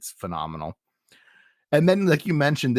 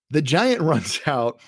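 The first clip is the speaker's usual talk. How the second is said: ordinary delivery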